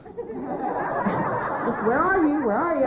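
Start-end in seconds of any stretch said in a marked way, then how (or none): 1.9-2.8 loud, high retold demand; alarmed demand. Woman on the phone to jailed daughter, loud alarmed demand. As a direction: fire the question out, high and loud.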